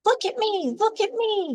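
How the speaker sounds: excited lamb shouting. A narrator's reading. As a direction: show off, calling to ma. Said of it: "he shouted".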